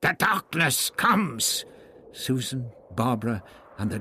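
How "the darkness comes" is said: high, slow barked proclamation; barked warning. A narrator's reading. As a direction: bark it out with drawn-out urgency. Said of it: "the High Priest of Sacrifice barked".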